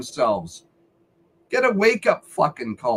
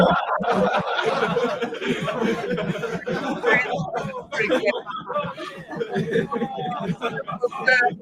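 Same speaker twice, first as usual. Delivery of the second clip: laughing; 5 dB quieter than usual. Real, not acted. Laughing at angry caller.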